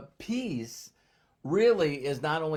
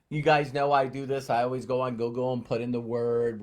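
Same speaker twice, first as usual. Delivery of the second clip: ordinary talk, nothing marked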